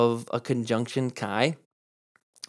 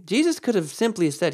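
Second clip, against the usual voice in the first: ordinary delivery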